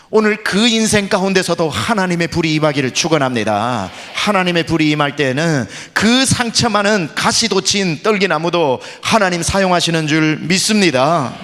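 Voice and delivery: loud, fervent pulpit blessing; forceful preaching. Acted. Pastor proclaims God's fire over the congregation, loud preaching.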